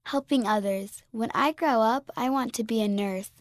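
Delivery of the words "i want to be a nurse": ordinary delivery, nothing marked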